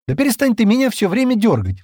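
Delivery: high, loud angry outburst; flaring up. A narrator's reading. Широ to Джема who keeps tugging her, flaring up. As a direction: snap at someone who keeps pestering you. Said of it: "вспылила Широ".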